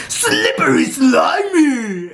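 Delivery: high, drawn-out mock wail; contestant's cry. Imitating a contestant during an activity, high mock wail. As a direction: imitate a silly squealing contestant, loud and slow. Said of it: "somebody was like".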